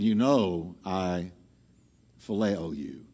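low, soft, slow admission; subdued Peter, voice sinking low; humbled. Acted. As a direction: answer quietly, brought right down. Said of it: "because he's been so humbled".

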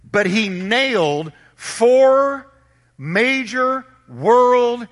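very slow, high-pitched, drawn-out proclamation; emphatic preaching. Real, not acted. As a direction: stretch each word to drive it home to the congregation. Preacher drives the key point home.

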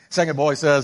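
ordinary delivery

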